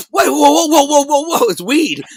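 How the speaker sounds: high, loud burst of whoas; excited surprise. Retold or imitated. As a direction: re-enact a startled, delighted discovery. Sudden excited discovery of weed.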